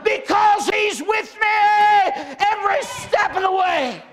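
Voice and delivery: high, loud, fervent sermon shout; preaching at full force. Acted. Preacher rousing his congregation about Jesus, shouting high and loud. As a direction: build and ring it out to the church.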